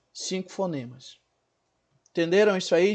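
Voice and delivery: ordinary delivery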